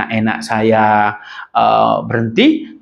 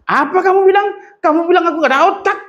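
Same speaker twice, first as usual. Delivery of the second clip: outraged shout, much higher and faster; angry retort; faster than usual. Retold or imitated. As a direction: snap back, offended and furious. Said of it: "Wah, marah".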